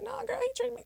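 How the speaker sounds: sassy high-pitched retort; mock high girly reply. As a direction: toss it off in a high mocking voice. Mocking reply to wife's text.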